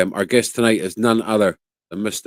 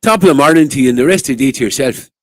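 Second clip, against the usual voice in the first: put-on fast, louder stage-Irish accent, higher; 4 semitones higher, 8 dB louder, faster than usual. Host answers the guest's Irish accent boast in a hammed-up Irish accent. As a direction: rattle it off in a hammed-up Irish brogue. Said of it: "I'm better at doing Irish accents".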